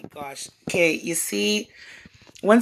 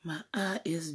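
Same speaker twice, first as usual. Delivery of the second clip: whisper; 4 semitones lower, 13 dB quieter than usual. Real, not acted. Whispered aside at start.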